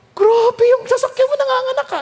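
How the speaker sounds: high, loud amazed cry about the car; amazed exclaim. Retold or imitated. Marveling to a friend whose car keeps multiplying, high loud amazement. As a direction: gasp out your disbelief, pitch flying up.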